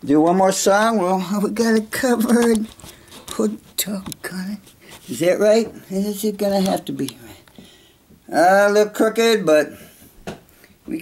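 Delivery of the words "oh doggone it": whispered, slow "doggone it" under his breath; hushed annoyed whisper at a mistake; whispered grumble. Real, not acted. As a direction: curse it softly to yourself, slowly. Mutters to himself over a mishap.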